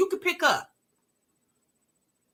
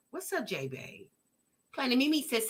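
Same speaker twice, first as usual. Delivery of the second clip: ordinary talk, nothing marked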